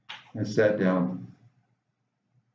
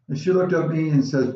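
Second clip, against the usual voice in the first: ordinary delivery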